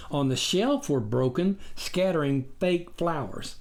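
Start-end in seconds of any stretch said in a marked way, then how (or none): none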